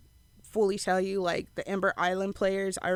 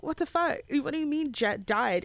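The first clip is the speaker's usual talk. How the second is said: high-pitched mock disbelief, easing down; retold shock; 7 semitones higher than usual. Fan retelling how they learned of a death, shocked. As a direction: replay the shocked reaction, pitched up.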